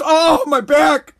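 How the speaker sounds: slow, whiny mock groan over Oh, my back. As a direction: ham up a fake back pain.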